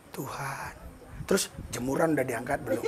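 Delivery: soft, drifting, half-hearted call to God; slow quiet prayer. Shown as a demonstration. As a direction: mumble it like your mind is elsewhere. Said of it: "kalau kita pelan". (doa pelan dan lemah yang setengah hati)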